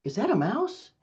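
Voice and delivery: mock alarmed excitement. Parent distracting a screaming child with a pretend mouse, hammed-up alarm. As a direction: sell a fake shock to distract the kid. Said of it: "you can also distract".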